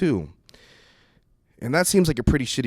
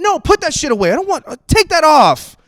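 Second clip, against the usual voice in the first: high, loud, frantic pleading; loud embarrassed yelling at mom; panicked yell; 9 semitones higher, 9 dB louder than usual. Retold or imitated. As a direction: shout at her to take it off. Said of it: "And I was like".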